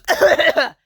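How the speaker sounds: imitated cough.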